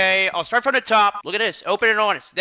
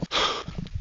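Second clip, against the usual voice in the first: mock gasp; 8 dB quieter than usual. Fake shock at his own confession.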